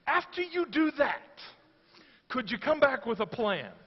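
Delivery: sarcastic onlookers wanting a plan; sarcastic people. Retold or imitated. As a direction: skeptical sarcasm, half-joking. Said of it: "That they're like".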